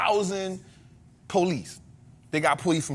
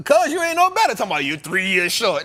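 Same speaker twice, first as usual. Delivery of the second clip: ordinary delivery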